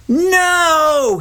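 high, drawn-out scream; screamed protest. A narrator's reading. Father jumps to his feet at the ballpark, screaming. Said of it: "he screamed".

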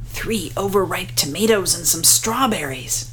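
ordinary delivery, nothing marked

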